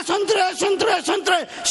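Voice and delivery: high, persistent hawker's chant; imitated vendor's call. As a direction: mimic a seller who won't stop calling. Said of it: "बोलता गया". (ऊँचे सुर में फेरीवाले की नकल)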